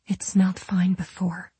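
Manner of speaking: timid, airy whisper; hushed, voiceless reply. A narrator's reading. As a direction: whisper it as a reluctant answer. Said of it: "Finally, she whispered".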